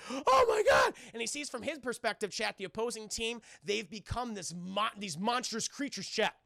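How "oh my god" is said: high, loud panicked scream; hammed-up scream of a scared quarterback; mock terror. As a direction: shriek it in mock horror. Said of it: "he's scared to death".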